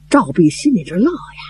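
ordinary delivery

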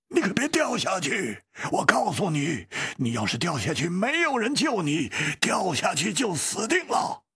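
high, insistent warning; urgent warning. A narrator's reading. Leader warns companion not to fall, urgent shouted warning. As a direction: warn hard, pitch pushed up.

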